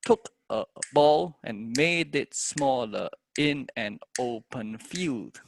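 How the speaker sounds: slow, steady metered recital; poem in four. Shown as a demonstration. Teacher trying the poem in four, slow measured recital. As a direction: beat out the verse in four, holding stressed syllables. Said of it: "try this in four".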